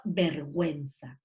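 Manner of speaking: slow "vergüenza" with the ü sounded as w; "vergüenza" said as ber-GWEN-sa, unhurried; U pronounced. Shown as a demonstration. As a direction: say "vergüenza" slowly, voicing the u after g. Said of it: "implica la pronunciación de la U".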